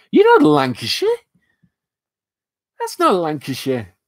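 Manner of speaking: amused disbelief at a wrong guess about his accent; incredulous denial. Real, not acted. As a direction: scoff at the idea in disbelief.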